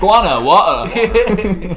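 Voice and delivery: slow, laughing drawn-out Guanajuato. Real, not acted. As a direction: stretch the name out through laughter.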